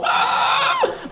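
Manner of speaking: very high squealing gasp; hammed-up allergic shriek. Allergic child begging to leave medieval times, hammed-up squeal. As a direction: squeal in mock panic. Allergic reaction interrupts the exchange.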